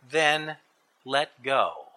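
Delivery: low, deliberate command; calm heavenly voice. A narrator's reading. Voice from above tells tourist to release, calm and low. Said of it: "The voice said".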